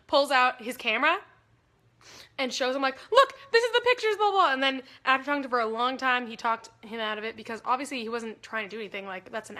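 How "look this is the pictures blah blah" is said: high, loud eager showing; eager pleading. Retold or imitated. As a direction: prove it to the cop, pitched up. Showing cop the pictures.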